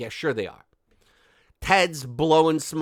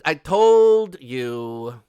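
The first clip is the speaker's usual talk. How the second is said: "told" stretched long and louder; slow, gloating "I told you"; drawn-out emphasis; 8 dB louder, much slower than usual. Shown as a demonstration. Host gloating to viewers he was right, slow and loud.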